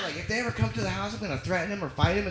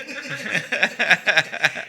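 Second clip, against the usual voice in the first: loud laugh; 3 semitones higher, 4 dB louder than usual. Real, not acted. After retold shout.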